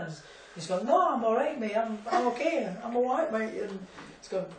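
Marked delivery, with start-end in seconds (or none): 0.9-3.6 high-pitched insistent protest, no I'm fine; squeaky, defensive friend brushing off concern; mock protest. A raised-pitch friend protesting to the desk staff.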